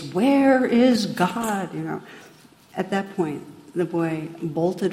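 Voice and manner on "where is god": loud, higher, long-stretched accusing question; loud stern demand. Retold or imitated. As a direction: boom it out slowly like an angry clergyman cornering a child. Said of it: "even louder now with his finger in the boy's face".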